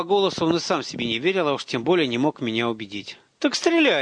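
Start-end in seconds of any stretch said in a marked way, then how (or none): 3.4-4.0 high, forceful challenge; raised voice calling his bluff; defiant dare. A narrator's reading. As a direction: throw the dare out sharply. Narrator dares boy to shoot.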